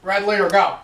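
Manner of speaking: pilot callout. Pretend. Playful movie callback.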